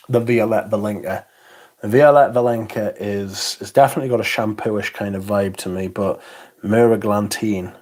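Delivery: normal talking voice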